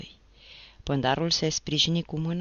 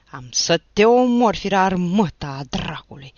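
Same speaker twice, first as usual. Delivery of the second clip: furious threat; 3 semitones higher, 9 dB louder than usual. Acted. Pândarul bate băiatul.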